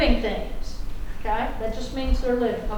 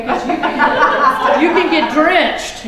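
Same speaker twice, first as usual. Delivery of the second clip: laughing as she warns, louder; 4 semitones higher, 10 dB louder, much slower than usual. Real, not acted. Teacher warning class about lion spray, laughing. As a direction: laugh through the warning, drawn out.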